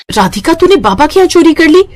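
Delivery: a friend's startled reproach. Acted. Friend scolding theft. (सहेली की ऊँची, हैरान आवाज़)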